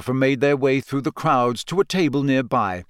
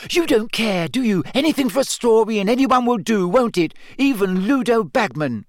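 much higher, louder furious attack; raging accusation; 10 semitones higher, 3 dB louder than usual. A narrator's reading. Hermione furiously accusing Rita. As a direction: hurl it at her.

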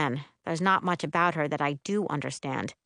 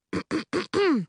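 high-pitched acted throat clearing; prim preparatory ahem; 11 semitones higher than usual. A narrator's reading. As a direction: clear the throat before a recital. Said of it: "She clears her throat".

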